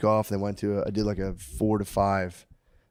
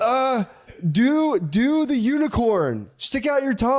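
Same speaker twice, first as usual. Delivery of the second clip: put-on high, slow little kid asking; drawn-out small child pleading; 4 dB louder, slower than usual. Dad voicing his kid asking for the unicorn face.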